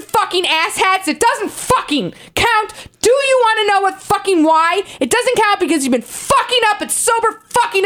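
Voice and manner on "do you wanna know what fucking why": usual speaking voice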